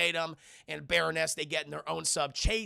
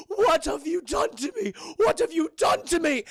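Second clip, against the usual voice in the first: high shouted horror; hammed-up loud despair; mock anguished shout; 10 semitones higher, 6 dB louder than usual. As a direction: cry out like a betrayed victim. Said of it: "He's like".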